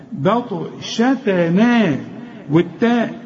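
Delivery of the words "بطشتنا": "بطشتنا" very slowly, thin vowels except heavy ط; light letters, heavy ta. Shown as a demonstration. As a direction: draw out "بطشتنا" with light "shatene". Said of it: "الحروف كلها مرققة".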